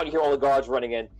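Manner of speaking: normal talking voice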